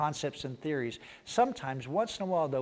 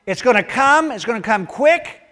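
higher, louder urgent warning; Jesus telling his followers, urgent and raised; 10 semitones higher, 12 dB louder than usual. Retold or imitated. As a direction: drive home that it's coming. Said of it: "he goes".

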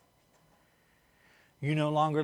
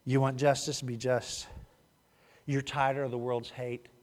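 softer, lower, calmer line; softer after apology; 6 semitones lower, 6 dB quieter than usual. Shown as a demonstration. Preacher calming down after yelling, softer and lower. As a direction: bring it down gently. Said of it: "Sorry about that".

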